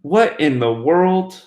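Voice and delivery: slow, drawn-out disbelief; baffled. Real, not acted. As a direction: stretch it out, baffled by the glitch. Skype glitch mid-show, host baffled.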